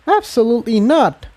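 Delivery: high, firm little filly; a small pony flatly refusing; young filly voice. Acted. Tiptoe agrees.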